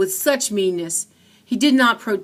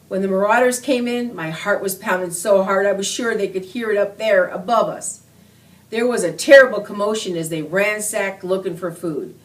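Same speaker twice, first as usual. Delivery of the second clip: ordinary delivery, nothing marked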